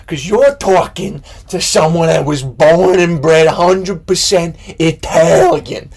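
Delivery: slow, loud, mildly cartoonish boast; lightly voiced proud Italian fan. Fan boasting to the band, slow loud mock pride. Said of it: "a cartoon of a person".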